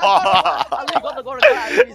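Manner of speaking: loud, high triumphant laughing; laughing gloat. Real, not acted. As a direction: cackle with glee over the win. Gloats over golden gun.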